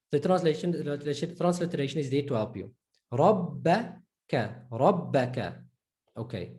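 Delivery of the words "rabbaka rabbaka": Rabbaka, slow, the doubled b held and stressed. Shown as a demonstration. Teacher to students demonstrating the doubled b slowly. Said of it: "You see the emphasis on the ba".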